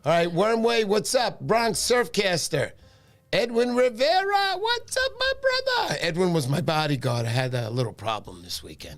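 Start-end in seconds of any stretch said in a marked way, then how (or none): 3.3-6.0 big high-pitched hello to a friend; excited shout-out. Real, not acted. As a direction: greet him like you're thrilled to see him. Shout-out greeting to viewer.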